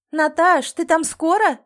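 loud, high call to another room; shouting through the door. A narrator's reading. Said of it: "прокричала она".

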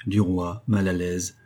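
usual speaking voice

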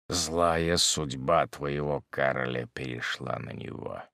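low, slow, soft brooding voice; heavy, measured bitterness; grim hermit, bitter. Acted. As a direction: drag out the confession darkly, sinking to a hush. Hermit continues his tale.

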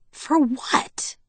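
high-pitched, indignant question; indignant challenge. A narrator's reading. Daughter challenges being grounded, indignant. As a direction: demand a reason, pitch up.

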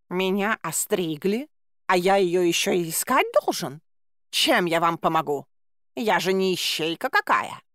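lightly voiced huffy cat, slowed at the start; a put-upon tomcat grumbling; lightly voiced cat, offended; indignant cat. A narrator's reading. Narrator voices the cat Bayun.

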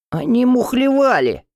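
высоко и громче, пискляво; пискнуть с возмущением; писклявый выкрик. A narrator's reading. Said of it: "пискнул Пеки". (Пеки обвиняет соперников, пронзительно пискнув)

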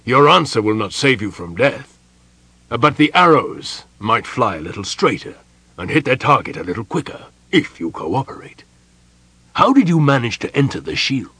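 loud, weighty interrogation; forceful official warning; portentous. A narrator's reading. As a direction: question with heavy, ominous authority. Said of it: "continued in portentous tones".

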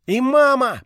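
very high, loud delighted cry; joyful. Acted. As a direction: cheer at seeing mum. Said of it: "порадовалась Вжих".

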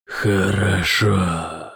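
very slow otherworldly demon. A narrator's reading. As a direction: stretch it out like a demon. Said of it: "ответило создание".

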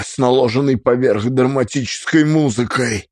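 ordinary delivery, nothing marked

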